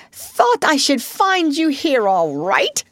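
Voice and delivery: high, slow, hearty cheerful greeting. Acted. Otter greets his friends at Badger's, cheerful. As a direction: call it out cheerfully, high and drawn out. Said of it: "said the Otter cheerfully".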